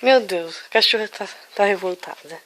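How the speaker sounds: ordinary delivery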